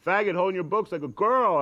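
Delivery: mock him down the hallway; bully taunt. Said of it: "they're like".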